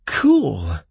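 loud excited squeak; excited little outburst. A narrator's reading. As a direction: squeak it out, high and eager. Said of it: "squeaked Kalen".

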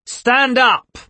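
high, loud snapped command; barked order. Acted. Hatch drilling the board, loud barked command. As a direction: bark it like a drill sergeant.

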